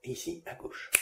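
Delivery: whispered close to the ear; right in your ear. Shown as a demonstration. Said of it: "je suis en train de claquer les doigts derrière".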